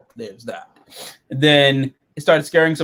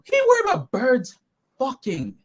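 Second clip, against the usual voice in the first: incredulous; 9 semitones higher, 4 dB quieter than usual. Real, not acted. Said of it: "I literally write that down".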